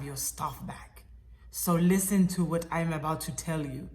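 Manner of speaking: put-on deliberate Russian accent, pausing between phrases. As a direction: imitate a calm Russian caller. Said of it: "in my best Russian accent".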